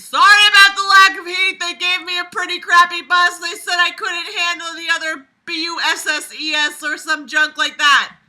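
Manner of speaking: loud, high yelling bus driver; mocking screaming bus driver; screamed. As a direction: shout it over the noise of the bus. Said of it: "The bus driver screamed".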